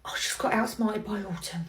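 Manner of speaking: low whispered defeat; whispered aside. Acted. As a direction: whisper it to yourself, beaten.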